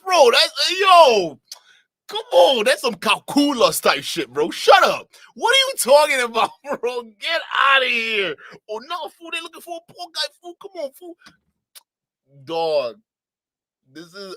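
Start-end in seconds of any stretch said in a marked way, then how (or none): 0.0-8.4 high, loud laughing disbelief; shouting in mock outrage; incredulous shout. Real, not acted. 8.7-11.1 mock his put-on vato voice, pitched way up; exaggerated mock Chicano slang voice; mocking Mexican accent. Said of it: "Mexicans don't".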